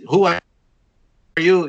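imitating a French host's sharp question; French accent. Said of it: "with his French accent".

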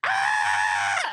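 mock scream. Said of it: "she just went".

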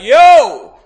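imitating a market seller, very loud and high; big drawn-out hawker's cry; street vendor's shout. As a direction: holler it across a busy market. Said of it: "they would just shout".

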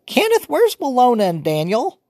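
put-on high, loud old woman fussing; fussy grandmother scolding; old grandma voice. A narrator's reading. Grandmother woken early, fussing at the noisy children.

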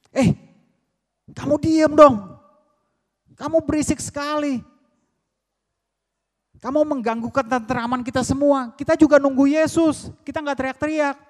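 high, loud telling-off; acted crowd yelling at someone to shut up; exasperated high-pitched shout; angry scolding. Crowd angrily scolding the blind beggar.

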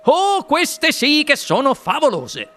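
high, slow, thrilled exclamation; Wonka esclama. A narrator's reading. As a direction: stretch it out in delight. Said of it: "esclamò il signor Wonka". (esclamazione acuta, lenta ed estasiata)

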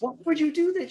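very high, loud protest; mock outraged cry. Gunman confronts wife over the gun, high loud mock outrage. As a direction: imitate someone wailing in betrayal.

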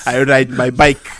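loud caricature French accent. Shown as a demonstration. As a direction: announce it like a stage Parisian. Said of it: "give me your best French accent".

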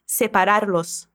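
Separarlos slowly, los at the end clear. Shown as a demonstration. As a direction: spell out Separarlos. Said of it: "esse los no final do verbo".

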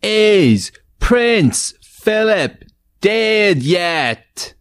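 high, slow, drawn-out title call; game show title call. Acted. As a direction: belt out the show's name like a host.

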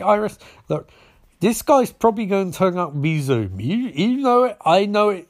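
high-pitched mock frustration; exasperated. Barry argues with Iris.

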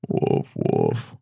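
a deep joke dog bark. Pretend. Host barking as a dog to tease his friend. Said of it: "I'm a dog".

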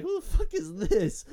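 incredulous. Retold or imitated. Boys react to a stranger.

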